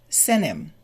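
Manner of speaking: SENT HIM said as "senim"; fast blended form. Shown as a demonstration. Teacher shows the really fast form, SENT HIM as "senim". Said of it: "if we say it really fast".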